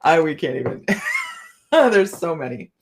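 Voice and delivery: ordinary delivery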